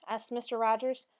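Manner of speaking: plain reading voice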